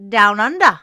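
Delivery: a loud, hammed-up Australian accent that drifts Scottish; attempted Australian accent. Podcast host introducing his Australian co-host, loud mock Australian accent. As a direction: bellow "Down Under" in a botched Aussie accent. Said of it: "So you went Scottish".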